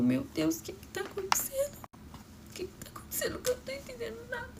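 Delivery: high, fast, broken whispered crying; tearful panic. Retold or imitated. A girl at the hospital begging to understand, high tearful whisper. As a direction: gasp it out through tears, lost and scared.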